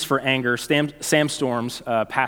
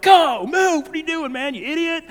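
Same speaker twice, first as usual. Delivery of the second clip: much higher mock yell at the car ahead; retold angry yelling. Girl behind him yelling at the stopped driver, much higher mock yell. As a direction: play the impatient girl honking and yelling. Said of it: "She's yelling, like".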